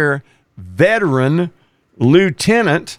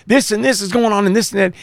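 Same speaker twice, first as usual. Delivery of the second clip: fast, higher, excited babble; hyped rookie; 6 semitones higher, much faster than usual. Retold or imitated. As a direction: rattle it off all worked up. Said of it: "we'd all be hyped up".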